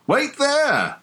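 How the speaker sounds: high, sharp shouted call; high-pitched shouted wait there; retold shout. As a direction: call out the order from down the hall. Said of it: "him sort of shouting".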